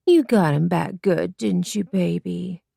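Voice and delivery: silly breathy coo, slow at the end. Acted. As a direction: coo it like baby talk to him. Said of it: "cooed at him in a silly, breathy voice".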